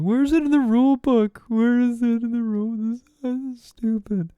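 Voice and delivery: mock the outraged fans in a high, pinched complaining voice; whiny complaining fans. People giving Hamilton shit, high mocking whine.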